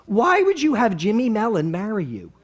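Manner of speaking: put-on high-pitched, wailing Philly accent; relative's Philly accent. Appalled relative questions the bride, exaggerated Philly accent. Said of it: "In that accent".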